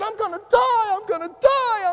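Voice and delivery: high, loud, rising panic; frantic cry of a man about to die; panicked realization. Retold or imitated. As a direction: blurt it out as the danger closes in. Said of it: "he starts thinking".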